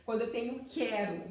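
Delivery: ordinary delivery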